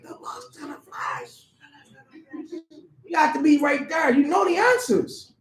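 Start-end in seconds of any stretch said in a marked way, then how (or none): 0.0-1.5 teasing whisper. Acted. Teasing congregation about their answer.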